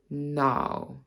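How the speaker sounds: 'now' with an RP vowel; RP accent. Shown as a demonstration. Teacher contrasting RP with her own accent, RP accent. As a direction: RP accent, placed on the bottom lip. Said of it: "show you the difference between".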